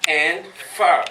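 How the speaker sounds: Fa said loud and separate; stressed syllable. Shown as a demonstration. Teacher splitting up Sankofa for the crowd, stressed syllable. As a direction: punch out Fa. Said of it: "to fetch".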